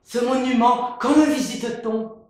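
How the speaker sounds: high, loud wide-eyed voice; naive. Acted. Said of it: "Naïf".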